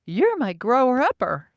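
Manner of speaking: put-on high, loud childlike voice; little kid voice. Narrator imitating the childlike being, high and loud. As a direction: sound like a small child in a grown man's voice. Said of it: "a little kid in Rob's voice going".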